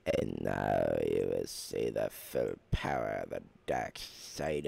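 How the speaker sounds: very low, slow, menacing emperor voice; deep Sith lord voice. Acted. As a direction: growl it like an evil dark lord foretelling doom. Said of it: "How do you guys like my accents?".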